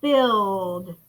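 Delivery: "Filled" held as one syllable, closing on D; final-sound demonstration. Shown as a demonstration. Teacher lets class hear the D, "Filled" stretched. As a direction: say "Filled" low and drawn out. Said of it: "I hear a D sound".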